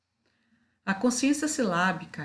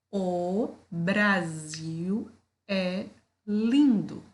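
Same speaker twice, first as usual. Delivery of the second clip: "O Brasil é lindo" drawn out slowly with pauses between words; slow, deliberate classroom dictation; 4 dB louder, much slower than usual. Shown as a demonstration. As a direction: split each word apart slowly for children. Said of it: "vou falar pausadamente".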